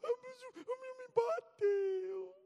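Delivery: very high, slow comic wail; exaggerated tearful whine; mock crying child. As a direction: blubber it like a bullied little boy. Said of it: "que que você tá chorando".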